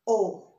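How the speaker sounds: O as a pure Spanish vowel; open Spanish O. Shown as a demonstration. Teacher corrects an English-accented taco, open pure O. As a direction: say O with an open mouth, no glide. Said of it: "you should open your mouth".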